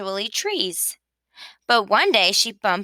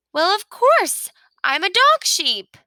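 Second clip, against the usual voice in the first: much higher and louder, thrilled; delighted exclamation; 10 semitones higher, 4 dB louder than usual. Acted. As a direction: burst out with proud joy. Sheep delighted at new role.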